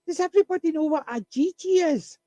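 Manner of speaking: high, lightly voiced riddle-teller; quoting a coworker. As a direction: lightly mimic the coworker posing her riddle. Coworker's riddle quoted in story.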